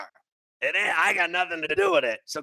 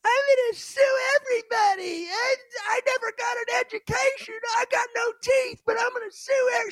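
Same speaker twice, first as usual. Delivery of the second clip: mocking whiny woman. Host mocking a protester threatening to sue, shrill whiny caricature. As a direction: caricature her as a wailing fool. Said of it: "Some chick".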